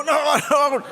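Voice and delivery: loud, high mock shout of dread; alarmed brothers. Brothers seeing trouble coming, loud alarmed shout. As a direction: cry out to stop him. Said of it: "My brothers go".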